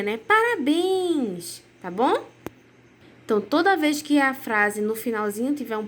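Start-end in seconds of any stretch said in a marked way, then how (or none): 0.2-1.6 slow, drawn-out and higher happy cheer; joyful congratulations. Shown as a demonstration. Teacher showing pupils a joyful exclamation, higher and drawn out. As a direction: really celebrate someone with it. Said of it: "com um tom mais, é, de alegria".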